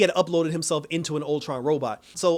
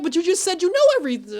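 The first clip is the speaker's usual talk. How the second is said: much higher, louder, fast disbelieving comeback; incredulous retort; 14 semitones higher, 6 dB louder, faster than usual. Retold or imitated. Fan scolding the Watcher, incredulous and fast. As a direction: call him out in open disbelief. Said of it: "And I'm like".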